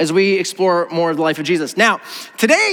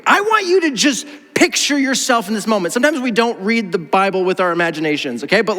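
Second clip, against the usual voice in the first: normal talking voice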